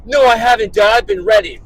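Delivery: loud mock-indignant son; protesting kid. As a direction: imitate a kid arguing back at dad, loudly. Retells son's protest to dad.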